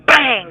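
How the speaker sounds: sharp high shouted bang; mock punch bang. Telling how the officer hit his jaw, shouted bang. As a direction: punch the sound out like a blow landing.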